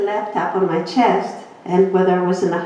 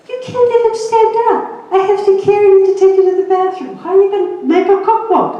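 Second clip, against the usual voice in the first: husband's disbelief, much higher and louder; exasperated husband; 13 semitones higher, 5 dB louder, faster than usual. Retold or imitated. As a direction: play the incredulous husband scolding her, fast in the middle. Husband's exasperated retort retold.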